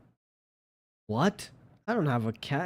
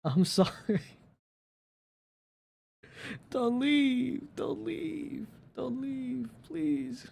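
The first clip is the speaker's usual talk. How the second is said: high, whiny mock plea; pleading; 10 semitones higher, slower than usual. Real, not acted. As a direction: beg the viewers not to go. Pleading viewers not to leave.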